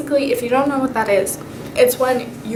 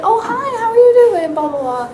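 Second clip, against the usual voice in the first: surprised; 11 semitones higher, 4 dB louder than usual. Retold or imitated. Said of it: "she just sounded really surprised".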